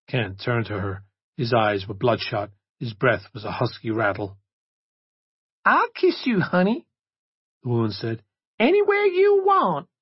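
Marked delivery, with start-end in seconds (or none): 5.6-9.9 sultry woman. Acted. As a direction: lure him in. Masked woman offers kiss.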